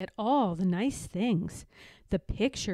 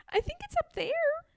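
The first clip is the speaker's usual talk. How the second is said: very high, tiny bird voice; tiny baby robin. A narrator's reading.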